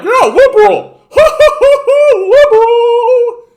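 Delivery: very high, loud, slow goofy idiot voice with a laugh; hammed-up moron cheering for liberals; dopey loyal voter. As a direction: play the voter as a brainless fool. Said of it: "we're still like".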